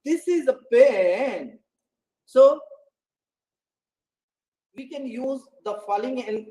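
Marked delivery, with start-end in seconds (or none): 0.0-1.6 slow, drawn-out "pen" with a doubting fall then rise; doubtful fall-rise. Shown as a demonstration. Teacher handed a pencil, doubting it is a pen. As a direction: sound unsure, questioning the pen. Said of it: "to show our doubt".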